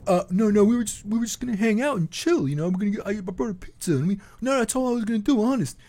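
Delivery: hammed-up guilty denial; caught predator. Caught man pleading innocence to the host, high mock. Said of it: "the guy would be like".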